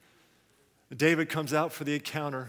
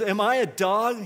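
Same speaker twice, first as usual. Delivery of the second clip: louder, higher, indignant challenge; mock roar; 5 semitones higher, 7 dB louder than usual. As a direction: roar it as an offended giant, half-strength. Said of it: "He roared at David".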